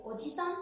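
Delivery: "oji-san" with a short I, rising; sin alargamiento. Shown as a demonstration. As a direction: keep "oji-san" quick, no lengthened vowel. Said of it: "no existe ningún tipo de alargamiento". ("oji-san" con i corta, sin alargar)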